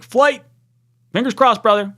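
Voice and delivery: high, loud rallying call; excited rally shout; hyped. Real, not acted. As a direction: pump up the fans.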